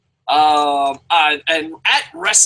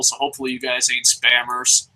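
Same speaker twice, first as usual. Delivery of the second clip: normal talking voice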